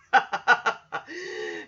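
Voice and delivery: amused chuckling in a short burst; real laugh. Real, not acted. Podcaster tickled by the actor having fun, laughing. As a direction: laugh along, genuinely tickled.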